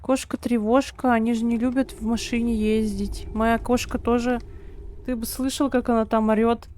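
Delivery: usual speaking voice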